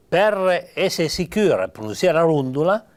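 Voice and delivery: normal talking voice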